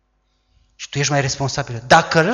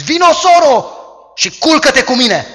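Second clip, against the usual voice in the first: high, loud urgent demand; forceful come-on; 10 semitones higher, 9 dB louder than usual. Acted. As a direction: grab her and press her, voice raised. Amnon grabs his sister.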